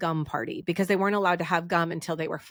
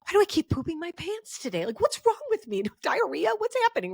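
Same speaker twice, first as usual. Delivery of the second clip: put-on high, bewildered little-girl voice, rising in alarm; panicked young girl; 10 semitones higher, faster than usual. Recalling her first period, she voices her confused younger self in a much higher voice. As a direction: play her younger self baffled and worried. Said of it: "And I'm like".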